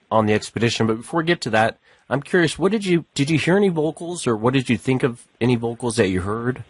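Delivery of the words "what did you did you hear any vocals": normal talking voice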